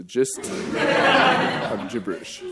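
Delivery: slowly sounds out the gist of gibberish, half laughing; slow careful tongue twister. Shown as a demonstration. Trying the tongue twister for the crowd, slow and careful. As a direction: take it slow, each word spelled out. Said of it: "Try saying that ten times".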